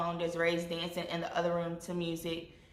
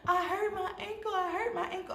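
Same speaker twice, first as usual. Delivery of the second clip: high, pained plea; hurt crying; 13 semitones higher than usual. Retold or imitated. Injured on the floor, telling her sister through tears, high and pained. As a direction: whimper it up high like you're hurt. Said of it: "And I was like".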